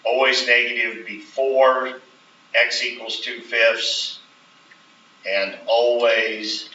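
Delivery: slow and deliberate, "always" stretched and stressed; emphasis on always. Shown as a demonstration. Teacher telling students what to write, stressing "always". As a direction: lean on each "always" so it can't be missed. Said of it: "the emphasis on the word always".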